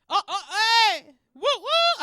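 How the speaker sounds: high, loud hype whoops; whooping. Real, not acted. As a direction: whoop like a party crowd. Cheering crowd noise.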